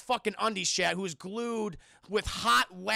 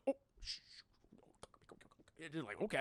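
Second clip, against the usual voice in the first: caught-out whisper; 5 semitones lower, 13 dB quieter, much faster than usual. Retold or imitated. Shaq caught as the DJ.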